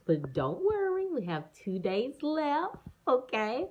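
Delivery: high, slow cheery reassurance; sing-song comforting; reassuring. Real, not acted.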